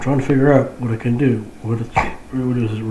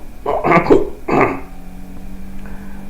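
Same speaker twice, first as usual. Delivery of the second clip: sharp involuntary hiccup; a real hiccup jolting out; 3 semitones higher than usual. Real, not acted. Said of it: "You notice I'm hiccuping".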